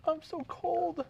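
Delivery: high, slow, shivering Rose in cold water; a man pitching up to play Rose. Acted. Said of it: "we're shivering".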